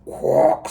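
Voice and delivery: loud unvoiced quack; duck quack. A narrator's reading. Reading the duck's line, a loud quack. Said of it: "said the duck".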